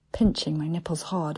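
ordinary delivery

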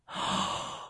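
sharp gasp of sudden pain; quick pained intake of breath. A narrator's reading. First nipple peg goes on.

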